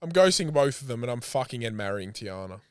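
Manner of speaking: normal talking voice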